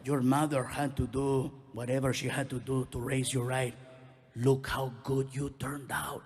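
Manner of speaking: low, heavy voice of a Mexican father; deep fatherly voice, slowing at the end; imitating his dad. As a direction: drop well down into a dad talking to his son. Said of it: "He goes".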